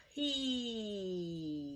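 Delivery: HE drawn out, long vowel sliding downward; slowly stretched. Shown as a demonstration. Teacher sounding out he for the class, slowly stretched. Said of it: "Let's say it slowly".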